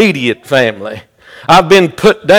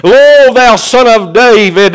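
high, raised preacher's plea, long-held opening words; crying out; 6 semitones higher, 3 dB louder than usual. Retold or imitated. Blind man begging Jesus for mercy, crying out. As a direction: call out pleadingly, stretching the first word. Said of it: "he begins to cry out and say".

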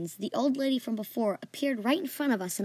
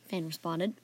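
usual speaking voice

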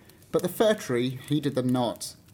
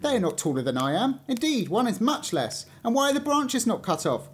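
indignant little tree; 8 semitones higher than usual. A narrator's reading. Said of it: "asked the fir tree".

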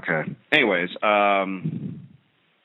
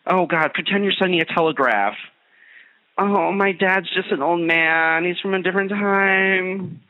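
mock excuse; 9 semitones higher than usual. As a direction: whine it like a defensive kid. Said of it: "nobody hides the telephone".

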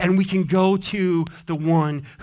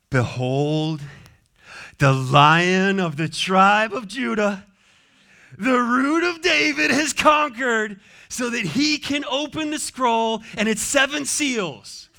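grand introduction; 6 semitones higher, slower than usual. Shown as a demonstration. The elder presenting the Lamb to John, building to a ringing herald's proclamation. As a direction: announce the Lion like a herald. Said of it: "the tone of an introduction being given".

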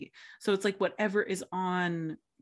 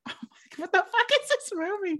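much higher, incredulous exclamation; baffled viewer; 12 semitones higher than usual. Retold or imitated. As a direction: gape at an absurd film.

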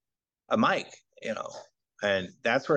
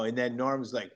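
ordinary talk, nothing marked

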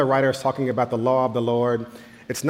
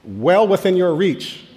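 slow, pointed reply, rising higher on "well within"; exasperated dad; 5 semitones higher, 4 dB louder, slower than usual. Acted. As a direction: spell it out slowly for the kid. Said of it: "I'd be like".

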